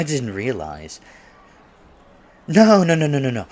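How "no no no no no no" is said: higher, louder rush of denials that settles down; hasty no no no. Acted. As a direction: quickly wave away the worry. Hastily reassuring the flustered date.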